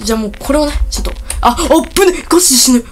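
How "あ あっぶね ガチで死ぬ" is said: high, loud panicked cry of a near miss; alarmed yelp. Real, not acted. Gamer nearly caught by the cop, panicked yelp. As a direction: yelp like you just dodged death. Narrow escape in game.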